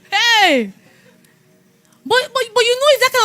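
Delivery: loud, high burst of "Hey!"; sudden exclamation. Real, not acted. Preacher bursts out to the congregation, loud and high. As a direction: call out to grab the room.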